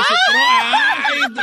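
high squealing laugh through the word. Real, not acted. Host teasing the caller about his crush, squealing laugh. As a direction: burst out laughing mid-word.

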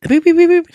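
high-pitched, rapid mouth-made beeping; imitated alarm beeps. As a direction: mimic a gadget alarm going off. Imitating a gizmo going off.

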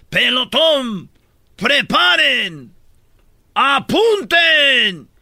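high, loud, drawn-out military commands; firing squad commander. Retold or imitated. Telling a joke, the officer commands the firing squad in a loud drawn-out voice. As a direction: bark the orders to the firing squad, slowly.

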